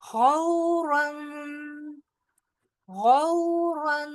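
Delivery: slow chanted word; tajweed recitation. Shown as a demonstration. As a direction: glide gently through गौ in गौरन. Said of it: "वाव लीन है, लिहाजा गौ".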